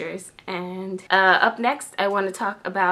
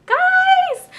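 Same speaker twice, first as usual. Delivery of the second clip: excited call; 6 dB louder than usual. Real, not acted. Excited news announcement to viewers.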